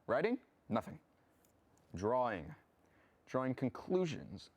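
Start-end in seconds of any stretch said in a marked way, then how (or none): 0.1-0.4 high startled echo of the question; feigned surprise. Acted. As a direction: play innocent, caught off guard. Caught writing, feigns surprise.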